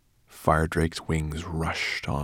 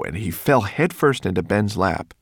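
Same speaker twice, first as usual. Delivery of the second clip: usual speaking voice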